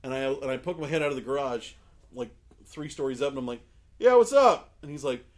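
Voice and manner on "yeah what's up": loud, high answering holler; calling down. Retold or imitated. Storyteller answers from three stories up, loud high retold call. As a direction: holler it to someone far below.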